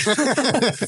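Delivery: a burst of high, loud laughter; real laugh. Real, not acted. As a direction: laugh out at the absurd claim. Laughs at the claim.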